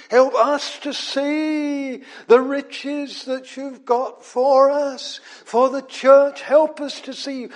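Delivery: groaning prayer. Acted. Preacher voicing a groaning prayer for the church. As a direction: cry out to God, pleading. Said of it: "What's he groaning for?".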